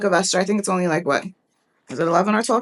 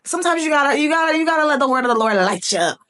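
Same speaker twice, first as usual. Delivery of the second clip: higher, rising into preacher's fervour; fired-up preaching; 6 semitones higher, faster than usual. Real, not acted. Preacher urging listeners to let the word move them, fired up. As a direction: let it light you up, pitched high and easing down.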